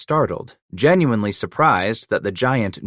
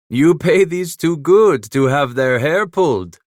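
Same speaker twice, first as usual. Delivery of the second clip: put-on teasing, a bit higher and louder, French-accented; French-accented Emile, mocking; 7 semitones higher, 7 dB louder than usual. A narrator's reading. Trapper Emile mocking Leclerc's runners, lightly French-accented. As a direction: needle him, half laughing in a French accent.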